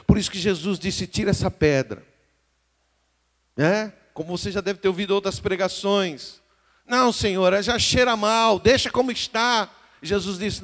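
high, loud preaching that builds and holds; full-force preaching. Acted. Pastor preaching to the congregation, full-force sermon voice. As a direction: preach it out to the whole church, full voice.

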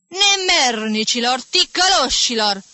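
slow, loud, high furious yell; violent shout. Acted. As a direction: blast the pupils like a raging teacher. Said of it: "strigă el cu o violență". (strigăt violent de profesor furios)

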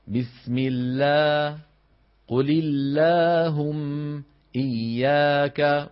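thin light alif in بسم الله and إياك; slow recited demo. Shown as a demonstration. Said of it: "ولا نقول إياك".

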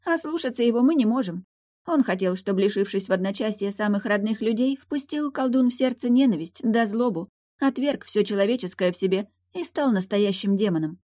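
high-pitched mermaid telling the prince's plan; explaining mermaid. Acted. Voices the mermaid.

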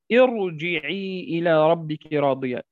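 slow recitation with heavy ra in ارجعي; ارجعي intoned slowly, ra thick; recited verse. Shown as a demonstration. Teacher recites Fajr verse showing heavy ra. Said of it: "في سورة الفجر".

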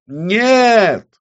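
very high, loud, drawn-out cry; screaming. Acted. Witch reaches for the escaping reflection, screaming. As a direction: wail it out to hold something back. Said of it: "закричала она так".

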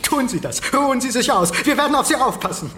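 höher und lauter, fast lachend; übertrieben begeistert beruhigen; überdrehte Fürsorge. Acted. Müller ruft überschwänglich. (Müller ruft dem müden Marlow überschwänglich zu)

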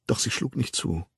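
ordinary delivery, nothing marked

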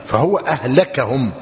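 أهلكهم with a after the kaf; fatha on the kaf. Shown as a demonstration. Teacher restates the reading to students, أهلكهم as ahlakahum. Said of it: "بالفتح".

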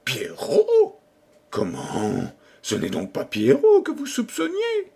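squeaky high disbelief; astonished. A narrator's reading. King and courtier at cross purposes over Pierrot, astonished. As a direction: shoot the pitch right up in surprise.

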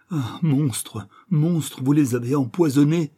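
loud, high outraged cry; horrified accusation. Acted. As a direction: accuse him, building in volume. Girl accuses the poisoner.

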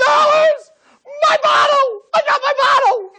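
very high, loud ecstatic scream. Acted. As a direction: shriek it in triumph. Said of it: "What is he screaming?".

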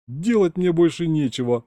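scoffing. A narrator's reading. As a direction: toss it off with a scoff. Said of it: "хмыкнул он".

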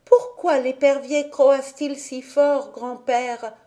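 put-on a wondering little girl; little girl Heidi. A narrator's reading.

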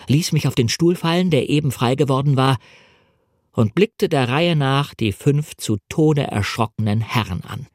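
ordinary delivery, nothing marked

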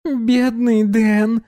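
high, slow teasing coo; giggly teasing. Acted. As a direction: tease him with a pleased giggle. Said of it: "довольно хихикнула Доминика".

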